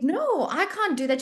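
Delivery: high, slow retold refusal; dismayed protest. Physio refusing her mentor's handover, dismayed. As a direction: protest it, drawing the words out high.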